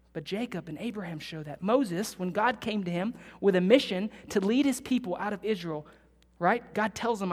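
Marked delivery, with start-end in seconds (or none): none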